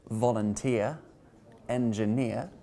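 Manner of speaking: "Volunteer" and "engineer", each stressed on the final EER; stress on final syllable. Shown as a demonstration. Teacher demonstrating suffix stress, "Volunteer" and "engineer" stressed on the last syllable. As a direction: put the weight on the endings of "Volunteer" and "engineer". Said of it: "which you generally stress".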